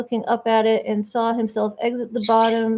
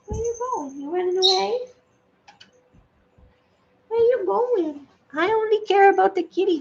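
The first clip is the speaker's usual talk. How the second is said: high, sing-song coo; 8 semitones higher than usual. Real, not acted. Streamer calling after her cat in high baby talk.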